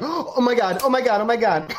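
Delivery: high, acted "oh my God" chant; thrilled disbelief. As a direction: play someone who just won a fortune. Girl becomes instant millionaire.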